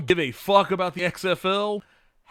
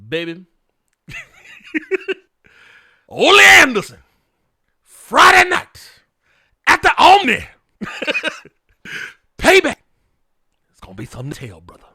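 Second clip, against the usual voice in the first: much higher and louder, roaring wrestling promo; Dusty Rhodes promo; 13 semitones higher, 11 dB louder than usual. Retold or imitated. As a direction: belt it out like a fired-up Dusty Rhodes. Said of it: "My Dusty Rhodes impression is on point".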